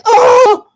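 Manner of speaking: sudden high, loud mock yelp; yelp after gunshot. She gets shot in the toe, mock yelp. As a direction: cry out high as if just shot. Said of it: "you hear a bang".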